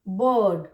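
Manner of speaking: "बर्ड" with a low, drawn uh and no r; long vowel, silent R. Shown as a demonstration. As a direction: stretch the vowel of "बर्ड" straight into the d. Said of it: "पहले वाले sound को लंबा खींच देंगे".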